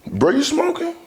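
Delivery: high-pitched surprised question. Retold or imitated. Surprised question to little brother.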